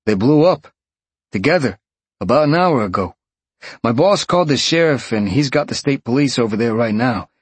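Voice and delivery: concerned detective on the phone; gruff detective. Acted. Detective voiced.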